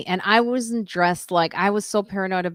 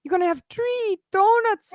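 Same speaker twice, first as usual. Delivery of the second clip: much higher, mock-horrified voice; imitating Lois shocked; 14 semitones higher than usual. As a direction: squeal it twice in disbelief. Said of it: "She goes".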